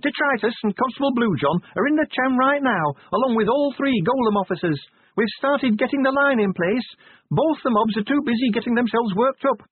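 high-pitched sergeant reporting over noise; brisk, high call through a noisy room; shouting over din. A narrator's reading. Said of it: "shouted Cheery over the din".

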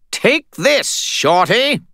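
high, loud spirit cheer; Bob's voice. A narrator's reading. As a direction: throw it out gleefully. Bob shouting encouragement.

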